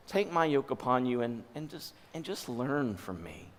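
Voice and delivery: soft, low, unforced plea; gentle invitation. Acted. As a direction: invite softly, letting the voice drop away. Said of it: "Do you hear the difference in Jesus saying".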